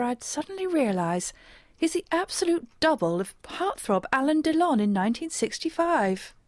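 usual speaking voice

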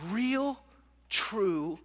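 normal talking voice

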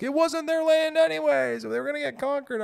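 very high, loud, strained idiot voice; mocking dumb commenter voice. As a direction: squeal it like a clueless troll. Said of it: "people that were like".

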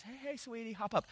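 soft, high, sweet wake-up voice; gracious coaxing. Acted. Jesus waking the girl like a napping child, gracious coaxing. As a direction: gently coax a sweetheart up. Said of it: "So this is very gracious".

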